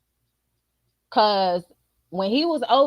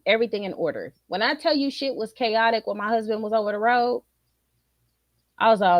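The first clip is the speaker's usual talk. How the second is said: ordinary talk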